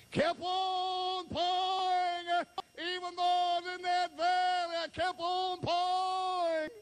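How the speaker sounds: high, loud, slow shouted refrain; preaching at full force. Acted. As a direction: shout the refrain to lift the congregation.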